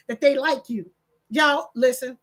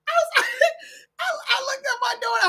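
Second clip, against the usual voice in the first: very high, squeaky with real laughter; laughing through words; 13 semitones higher, faster than usual. Real, not acted. Grandmother telling of turning to her daughter, laughing. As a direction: try to keep telling it while cracking up. Laughing too hard to tell story.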